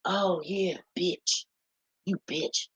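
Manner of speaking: half-hearted mock jab; mock insult. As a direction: toss it off like a teasing friend. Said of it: "Then you got the word".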